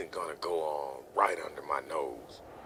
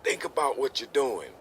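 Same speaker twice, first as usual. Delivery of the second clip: exasperated father, raised pitch and volume; angry parental scolding; 4 semitones higher, 4 dB louder than usual. Acted. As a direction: lecture the young couple, building in force. Father lectures young couple about risks.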